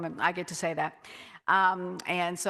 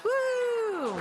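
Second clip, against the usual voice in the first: high little woo of triumph; celebratory whoop; 14 semitones higher than usual. Real, not acted. Pastor cheering her own long run, high whoop. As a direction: toss out a proud woo. Celebrating running thirty-one miles.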